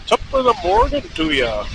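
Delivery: high-pitched stock Irish greeting; hammed-up Irish lilt, starting high and falling; bad Irish accent. Rider giving the stock greeting, bad Irish accent. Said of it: "can't do an Irish accent".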